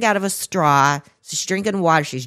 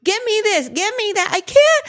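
high wailing mock cry; high wailing imitation of a crying daughter; crying scream; 14 semitones higher than usual. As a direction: wail it like the hurting kid. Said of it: "screaming, crying".

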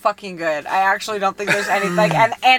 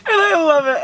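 laugh-crying; 8 semitones higher, 4 dB louder than usual. Real, not acted. Laughing at her guilty show.

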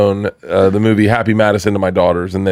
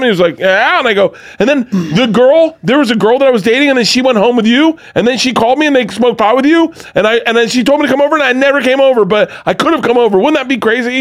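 panicking; 12 semitones higher than usual. Retold or imitated. Fan babbling a nervous college story at Adam Sandler, high and fast. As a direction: gush nervously at a rush. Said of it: "I'm fucking panicking".

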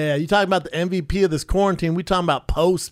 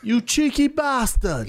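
high, slow mock Cockney jeer; Cockney accent; 9 semitones higher, slower than usual. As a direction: drawl it like a cheeky Londoner. Mock British insult.

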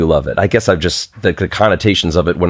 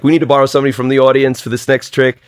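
quick Long Island showman patter, pitched up; old Long Island accent at a magic show; 6 semitones higher, faster than usual. Retold or imitated. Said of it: "I had to talk like this".

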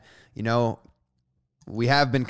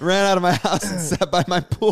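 higher-pitched mock sob story; teasing mockery; 6 semitones higher, 4 dB louder than usual. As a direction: tease him with a put-on, pitched-up pity voice. Host teases his rich-kid sob story.

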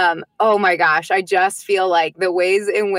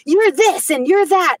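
put-on bossy toddler commands; 10 semitones higher, 4 dB louder than usual. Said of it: "he was like".

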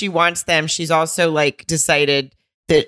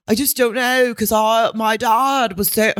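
higher-pitched mock Australian accent; 6 semitones higher than usual. Boyfriend explaining himself to his girlfriend, imitated Australian accent. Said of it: "that's my Australian accent".

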